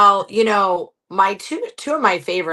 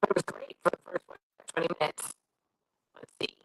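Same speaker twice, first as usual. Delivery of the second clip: crackling, breaking-up call audio; faint voice through static; staticky connection; 2 semitones lower, 11 dB quieter than usual. Real, not acted. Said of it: "it sounds pretty staticky still".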